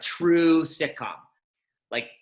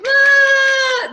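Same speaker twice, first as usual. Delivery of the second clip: much higher, very loud squeal of excitement; kids' excited scream; 12 dB louder than usual. Retold or imitated. Seven-year-olds greeting a celebrity, imitated excited scream. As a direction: scream like a room full of thrilled kids. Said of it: "Kids are like".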